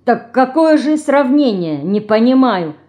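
bewildered. A narrator's reading. Sister shrugs at the comparison, bewildered. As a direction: protest as if baffled. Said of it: "пожимая плечами в недоумении".

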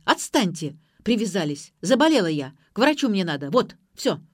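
loud, exasperated outburst; yelling. A narrator's reading. Mother yelling that she must go to the doctor, exasperated. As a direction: fire it off loudly, fed up. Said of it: "заорала мать".

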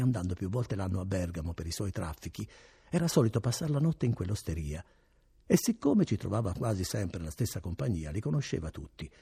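ordinary delivery, nothing marked

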